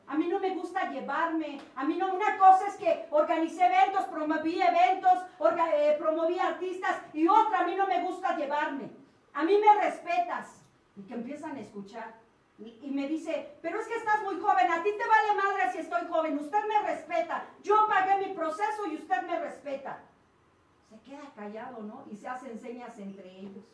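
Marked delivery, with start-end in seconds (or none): 0.1-10.6 loud, firm confrontation; firm demand. Retold or imitated. Confronta al compañero en comedor. 13.6-20.0 firm, loud demand for respect; exigiendo respeto. Retold or imitated. Confronta y exige respeto.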